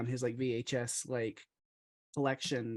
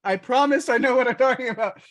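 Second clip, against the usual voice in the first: flustered protest; 9 semitones higher, 7 dB louder than usual. Retold or imitated.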